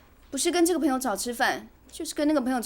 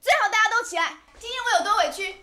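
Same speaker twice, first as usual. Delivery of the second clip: high, raised angry voice; aggrieved and defiant; 8 semitones higher, 4 dB louder than usual. Acted. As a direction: let the whole house hear your grievance. Wife defies warning about waking family. (委屈又赌气地高声嚷)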